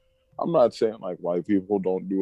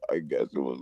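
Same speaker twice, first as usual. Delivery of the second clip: ordinary talk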